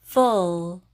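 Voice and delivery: "full" with the L as a final consonant; "full" yavaşça, L hece sonunda; English full. Shown as a demonstration. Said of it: "full 이렇게 읽으시면 돼요".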